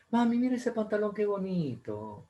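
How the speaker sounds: put-on high child voice, slowing on qué bonito; child begging mom for trousers; pleading child. Child asks mother.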